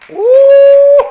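high loud whoop into a laugh; delighted whoop. Real, not acted. Preacher whooping to the church, then laughing. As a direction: whoop with joy at the good news.

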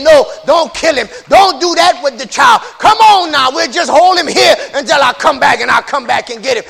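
pleading shout. Acted. Someone shouting the brothers down, loud pleading. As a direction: cry out to stop them. Voiced plea to spare child.

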